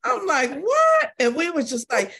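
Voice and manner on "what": loud, high-pitched "What?"; shocked disbelief. Retold or imitated. Churchgoer retells her shock at the bragging, stunned.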